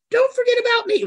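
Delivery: high falsetto puppet voice; Tilda Swinton. Acted. Puppet Tilda butts in, very high falsetto. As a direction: pipe up shrill and needy to be remembered.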